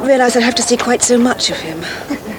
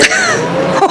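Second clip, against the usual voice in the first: amused laugh. Acted. Amused laugh at the Batmobile joke.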